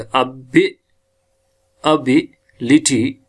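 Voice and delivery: अभी लिटी broken up, stress on भी; second-syllable stress. Shown as a demonstration. Teacher quizzes where accent goes, breaking अभी लिटी after stressed भी. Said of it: "फिर कहाँ लगेगा?".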